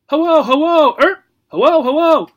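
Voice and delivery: loud high parrot squawk; screechy bird mimic. Parrot repeating.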